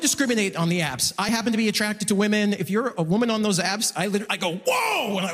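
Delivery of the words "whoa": high, shouted whoa; amazed whoa. Retold or imitated. Comedian seeing a woman's profile, amazed high whoa. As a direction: gasp it out in awe. Reacting to women on apps.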